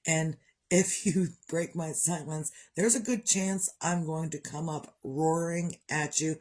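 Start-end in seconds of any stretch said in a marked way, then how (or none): none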